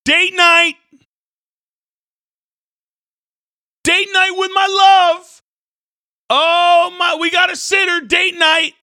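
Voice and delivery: high, loud gushing mock excitement; giddy couple. Preacher mocking unhappy couples, high loud giddy gushing. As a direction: ham up a couple thrilled about date night. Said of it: "they're always".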